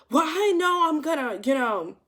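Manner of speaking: mock freak-out. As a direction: imitate a man flipping out. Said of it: "like, he freaks out".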